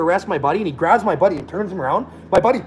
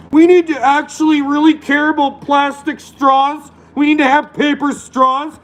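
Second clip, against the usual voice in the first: high, slow preachy parody voice; mock activist; 10 semitones higher, 4 dB louder, slower than usual.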